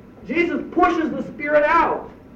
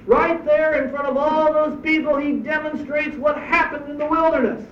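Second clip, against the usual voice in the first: usual speaking voice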